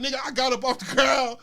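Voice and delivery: ordinary talk, nothing marked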